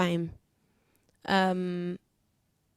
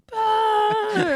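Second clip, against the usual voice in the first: high mock boo at the crowd; booing back; 13 semitones higher, 3 dB louder than usual. Booing back at the crowd who booed her. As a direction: boo straight back, cheekily. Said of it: "And I was like".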